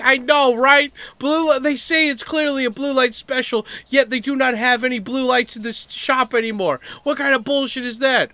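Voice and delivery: high, loud absurd rant; matched angry energy. Acted. Answering an angry guy with unrelated nonsense, mock outraged ranting. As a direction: rave indignantly, as if agreeing in outrage. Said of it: "You can match the energy".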